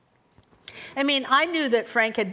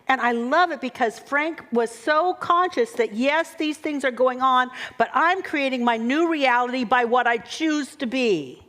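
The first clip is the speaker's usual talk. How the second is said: usual speaking voice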